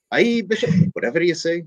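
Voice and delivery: high, breezy Irish lilt on "Aye, Bishop"; Irish priest. Retold or imitated. Irish priest cheerfully humours the bishop, mock Irish brogue. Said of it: "there's an old Irish priest".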